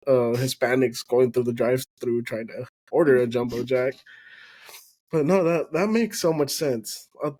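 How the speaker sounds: usual speaking voice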